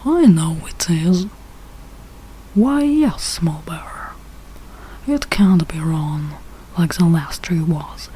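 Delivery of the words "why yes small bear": ordinary delivery, nothing marked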